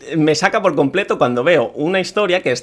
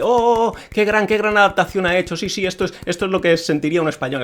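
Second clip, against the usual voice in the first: higher-pitched parody of an admiring crowd; mock gushing; 4 semitones higher than usual. Imitating players applauding a very Spanish game adaptation, mock gushing. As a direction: gush in exaggerated, mocking admiration. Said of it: "La gente".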